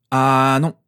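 loud, drawn-out refusal; cutting in firmly. A narrator's reading. Father interrupts mother letting them off, loud and firm. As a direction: cut across with a firm objection. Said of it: "la coupa James".

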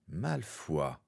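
low-volume, contemptuous naming; spat name. Acted. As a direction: spit out the enemy's name under your breath. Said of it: "cracha Steven". (nom craché avec mépris, sans hausser la voix)

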